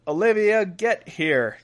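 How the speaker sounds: high, loud mock call to a daughter; imitated summoning call. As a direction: call the daughter over like an exasperated mother. Mother summoning daughter in story.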